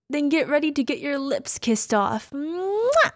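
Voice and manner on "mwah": high loud playful kiss sound; loud smacking kiss. Acted. Mrs. Claus kissing her partner loudly.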